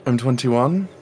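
talking through a mouthful of pancake; words muffled by food in the mouth; mouth full. Acted. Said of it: "Don't talk with your mouth full".

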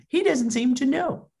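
high, mock-innocent aside; teasing, acted bafflement; cheeky. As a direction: imitate a cheeky maitre d'. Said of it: "in a cheeky way".

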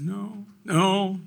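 high, tearful imitation of a child; mock crying. Boy confessing to his teacher, mock tearful. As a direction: whimper it like a caught boy. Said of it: "then I started crying".

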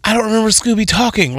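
ordinary talk, nothing marked

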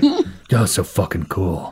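low, slow, understated awe; Tina in character. Acted. Tina to her reflection in the glass, low and slow. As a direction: drop low and draw it out. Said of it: "She's like".

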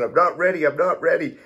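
high, loud frightened cry; panicked pleading. Retold or imitated. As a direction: repeat it in rising panic.

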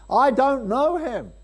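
high, loud and slow, every word hammered; loud denial. Retold or imitated. Preacher voices Peter's third denial to the crowd, loud. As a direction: throw the third denial out like a shout.

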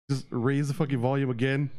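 ordinary talk

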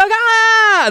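high-pitched acted scream; mock kid scream. Goblin kids out of sight, mock screaming. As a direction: squeal out a pretend shriek. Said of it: "they hear, like, screaming".

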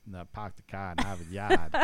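put-on Boston accent, dropped r's: Pahk the Cah and Hahvahd Yahd. Host teased about Boston voices, he does a Boston accent. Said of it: "do an accent".

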